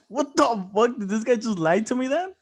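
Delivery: high, loud incredulous question; retold outrage. Realising the boss lied, re-enacted high and incredulous.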